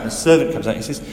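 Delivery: ordinary delivery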